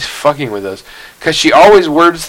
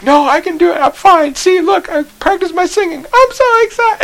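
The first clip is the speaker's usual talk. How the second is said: much higher, frantic protest; panicked teen. Retold or imitated. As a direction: insist you're fine while freaking out. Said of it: "she's like".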